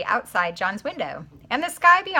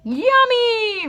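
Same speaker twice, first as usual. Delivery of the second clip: much higher, louder gleeful exclamation; delighted; 12 semitones higher, 6 dB louder than usual. A narrator's reading. John caught eating toothpaste, gleeful and loud. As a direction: squeal it with sugary delight.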